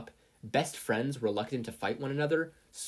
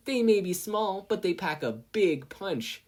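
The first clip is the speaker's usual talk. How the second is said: high, slow, smug threat; smirking boast; 9 semitones higher, 5 dB louder, slower than usual. Acted. Mina threatening Mei with her remote, smugly. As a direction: savour the punchline.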